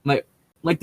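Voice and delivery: ordinary talk, nothing marked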